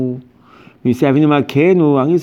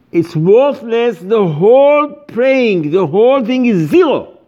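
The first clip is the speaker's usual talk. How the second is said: slow, drawn out and higher; emphatic; 7 semitones higher, 3 dB louder, slower than usual. Real, not acted. Rabbi telling congregants their prayer is worthless, slow and emphatic. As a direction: lean hard on every word, slowly.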